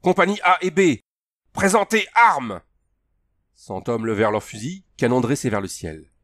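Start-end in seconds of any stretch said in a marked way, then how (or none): 0.0-2.6 high, loud drill order; shouted command. A narrator's reading. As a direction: order the companies, drawing out the last words. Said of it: "s'écria Andrew".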